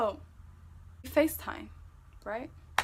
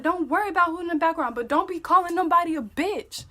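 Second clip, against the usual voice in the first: loud, raised-pitch scolding; re-enacted heated comeback; indignant retort; 7 semitones higher, 9 dB louder, faster than usual. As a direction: defend your sister forcefully. Defending sister in retold quarrel.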